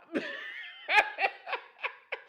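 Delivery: airy, high-pitched burst of laughter; a wheezing giggle that runs away with him; high breathless laugh. Real, not acted. Host cracking up at his own taunt, high breathless laugh.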